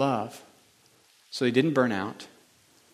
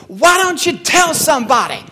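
much higher, louder voice of the woman preacher; preacher lady's challenge; 12 semitones higher, 6 dB louder than usual. Retold or imitated. Preacher lady challenging the congregation, high and loud. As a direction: call out to the crowd like she did. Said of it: "And he goes".

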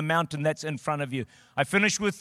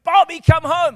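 calling out; 11 semitones higher, 9 dB louder than usual. Retold or imitated. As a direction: cry out into the distance for him. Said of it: "East and west".

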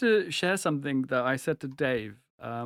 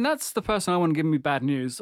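ordinary delivery

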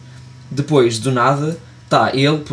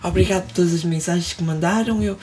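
put-on higher, softer kid voice; ten-year-old boy voice; 6 semitones higher, 4 dB quieter than usual. Imitating the boy sending kisses to followers, higher kid voice. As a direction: recite it like a shy little boy. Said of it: "completamente voz de puto de dez anos".

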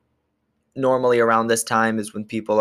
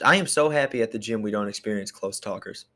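usual speaking voice

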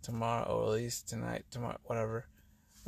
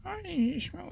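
very high, squeaky inner voice; high-pitched mock questioning; nagging brain's voice. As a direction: play your brain piping up in falsetto. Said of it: "my brain's gonna be like".

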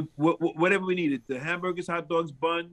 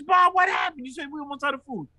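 much higher, urgent shout at the grill master; retold shout; 9 semitones higher, faster than usual. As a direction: yell the question in alarm. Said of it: "I says".

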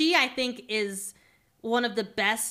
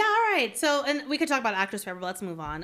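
ordinary talk, nothing marked

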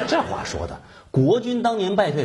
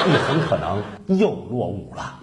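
ordinary talk

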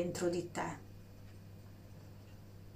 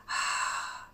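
voiceless breathy ah on the out-breath; audible exhale. Shown as a demonstration. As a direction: breathe out audibly to show the release. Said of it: "attraverso l'esalazione".

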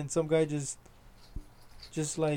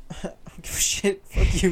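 tired and blunt; 3 semitones higher, 5 dB louder than usual. Shown as a demonstration. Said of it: "But when I'm tired".

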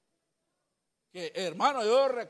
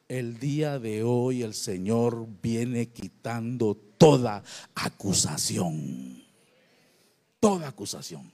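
slow and much lower proclamation; solemn preaching; 12 semitones lower, slower than usual. Real, not acted. Pastor declaring deliverance to the congregation. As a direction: intone it like a solemn promise.

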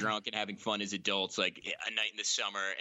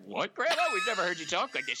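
mock surprised kid; 8 semitones higher than usual. Kids startled by drunk grandma.

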